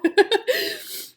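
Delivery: high teary giggle; high emotional laugh; tearful laugh. Real, not acted. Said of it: "I get emotional".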